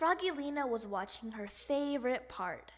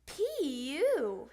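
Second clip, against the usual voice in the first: disgusted; 5 dB louder than usual. Acted. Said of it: "she said".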